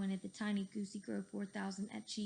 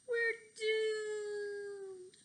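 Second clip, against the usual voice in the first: high, louder cry of doom; shouted; 12 semitones higher, 4 dB louder than usual. A narrator's reading. Cheeseball tumbling off the roof, high panicked shout. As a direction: yell it as if falling to your death. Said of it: "shouted Cheeseball".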